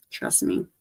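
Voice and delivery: usual speaking voice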